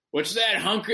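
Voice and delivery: gruff boss questioning, lightly imitated. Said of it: "my redneck boss".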